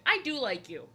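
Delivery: ordinary talk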